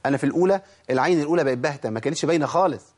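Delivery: ordinary talk, nothing marked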